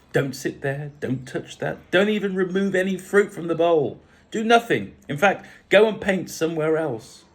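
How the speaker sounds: rising from firm to high, loud orders; building overzealous string of commands; overzealous fussing. A narrator's reading. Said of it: "Lucia was somewhat overzealous".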